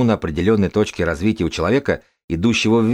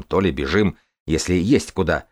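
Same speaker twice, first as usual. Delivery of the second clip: normal talking voice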